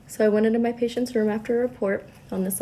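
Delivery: usual speaking voice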